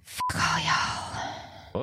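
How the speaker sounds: hushed curse. Real, not acted. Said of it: "mock exasperated hushed curse".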